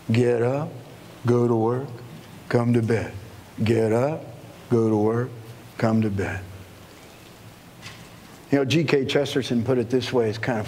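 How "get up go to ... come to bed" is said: low, toneless daily-routine list; weary, even monotone chant; monotonous drone. Acted. Listing the monotony of days.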